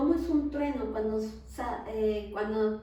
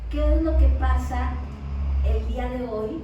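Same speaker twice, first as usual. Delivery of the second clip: loud, ringing pulpit proclamation; full-force preaching; 10 dB louder than usual. Acted. Preacher proclaiming to the congregation that God has not changed, loud. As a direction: preach it out to the whole hall.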